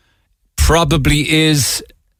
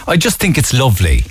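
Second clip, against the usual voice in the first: usual speaking voice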